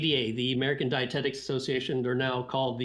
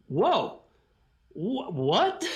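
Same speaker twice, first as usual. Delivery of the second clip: shocked whoa; 4 semitones higher, much slower than usual. Retold or imitated. Hearing the president's advice at a party, startled. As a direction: jolt up in disbelief on whoa and what. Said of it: "So that really shocked me".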